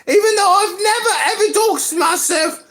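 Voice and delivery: high, put-on Australian woman's voice; fake Australian accent. [name] introducing herself to Clubhouse, in an exaggerated fake Australian accent. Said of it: "I love using this fake accent".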